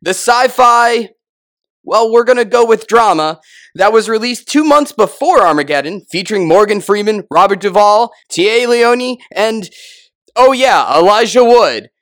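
high, booming, drawn-out ring announcer; Bruce Buffer. Acted. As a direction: stretch the name out like a fight intro. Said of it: "we have Bruce Buffer Beam".

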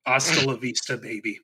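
soft, deflated catchphrase; Schwarzenegger without accent. Acted. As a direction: toss the action line away, fading out. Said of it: "doing his lines without the accent".

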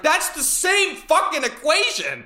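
high, slow, emphatic retold outburst; exasperated retort. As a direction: drag it out in outraged disbelief.

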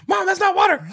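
high, loud comic scream; mock panicked yell. Burning dad to mom holding oil, yelling. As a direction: yell it in exaggerated alarm.

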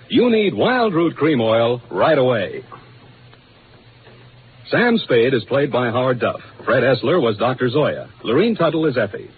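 ordinary talk, nothing marked

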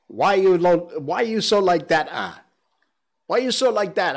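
loud, annoyed Singlish complaint. Retold or imitated. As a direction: scold someone for being difficult, Singlish style. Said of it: "When somebody's being annoying".

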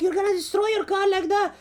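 imitated girl's shrill scolding, high and fast; shouting. Girl warning the driver about his car, shouted imitation. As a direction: rush it out in a high mock yell. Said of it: "she was fucking shouting in my ear".

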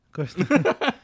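echoing through a laugh. Real, not acted. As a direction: laugh the words out. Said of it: "echoes with a laugh".

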